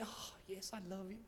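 very soft, sighed declaration of love; tender answer. Acted. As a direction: murmur it like someone in love. Acts out tender lover's answer. (respuesta enamorada en voz muy baja)

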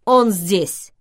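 hurried loud confirmation; loud interruption. A narrator's reading. Olympus hastily cuts off the narrator to answer Vivian, loudly. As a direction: cut in loudly, as a firm statement. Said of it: "громко перебил меня Олимпус".

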